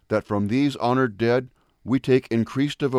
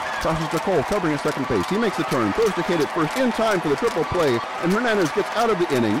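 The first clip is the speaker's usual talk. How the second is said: building from calm call to excited rush, higher and faster; play-by-play; 6 semitones higher, faster than usual. Acted. Radio announcer calling a triple play live, building excitement.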